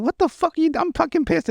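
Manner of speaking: high-pitched flustered protest, imitated; panicked mock outrage. Voicing Timmy caught in the bathroom, panicked mock outrage.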